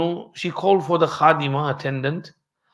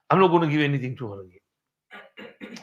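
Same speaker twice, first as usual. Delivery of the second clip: mild angry vow, softer and muttered under breath; quiet retold vow; 2 semitones lower, 5 dB quieter than usual. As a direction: repeat the vow softer, as if to himself. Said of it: "he got angry".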